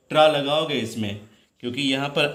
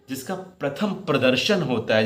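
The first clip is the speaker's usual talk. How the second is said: normal talking voice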